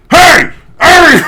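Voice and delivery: high, very loud strangled shout; mock failed whisper yell. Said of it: "you're still being loud".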